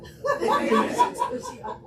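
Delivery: loud, high burst of laughter; real cackling laugh. Real, not acted. Laughs about the sweetheart.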